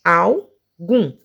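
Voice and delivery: "Algum" split into al, gum; two separate beats on "Algum"; syllable by syllable. Shown as a demonstration. Teacher showing two syllables, "Algum" broken in two. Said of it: "duas sílabas".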